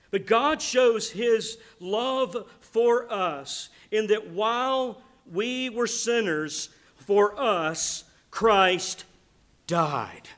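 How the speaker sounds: very slow and loud, leaning on "God" and "died"; hammering it home. Shown as a demonstration. Pastor hammering the verse home to the congregation, slow and emphatic. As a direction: drag out each word of "for us Christ died". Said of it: "Hammering home value, that you really get it".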